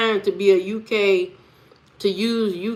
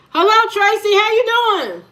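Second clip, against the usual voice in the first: cheery greeting; 10 semitones higher, 4 dB louder than usual. Real, not acted. Greeting a chatter by name.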